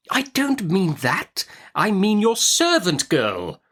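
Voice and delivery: high, slow stern correction; Miss Betsy. Acted. Betsy correcting the confused mother, slow and stern. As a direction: spell it out sternly like an old aunt.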